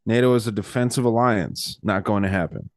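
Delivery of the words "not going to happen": ordinary talk, nothing marked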